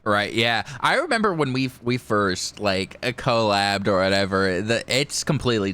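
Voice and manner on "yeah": ordinary talk, nothing marked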